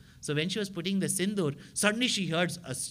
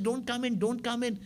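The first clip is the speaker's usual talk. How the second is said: panicked plea; 9 semitones higher than usual. Acted. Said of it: "She said".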